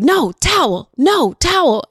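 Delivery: put-on high, loud toddler whine; a toddler yelling at mom about the towel; angry toddler yell. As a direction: yell it like a child refusing. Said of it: "And he's like".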